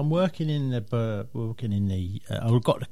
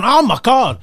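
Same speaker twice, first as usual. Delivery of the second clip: sudden loud, high yelp; excited shout; 10 semitones higher, 11 dB louder than usual. Retold or imitated. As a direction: yell as the ball flies for six. Excited by cricket six.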